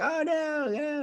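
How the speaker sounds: high-pitched mock crowd wail; protesting crowd. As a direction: wail it high like a dismayed mob. Crowd's protest voiced in retelling.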